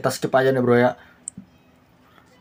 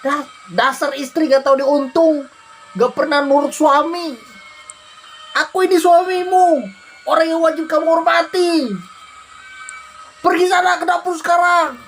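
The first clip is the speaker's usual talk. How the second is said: higher, mildly hammed-up scolding husband; angry husband; 10 semitones higher than usual. Husband ordering his wife to the kitchen, mildly angry. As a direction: bark orders like a domineering husband.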